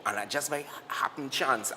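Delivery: ordinary talk, nothing marked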